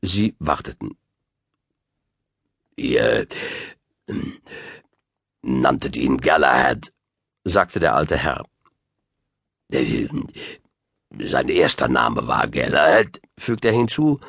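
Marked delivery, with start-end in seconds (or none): 2.8-6.9 put-on low, halting old man; old wizard. A narrator's reading. Old gentleman addressing his hosts, low and halting. 9.7-13.2 put-on low, halting old man; old wizard. A narrator's reading. Old gentleman addressing his hosts, low and halting.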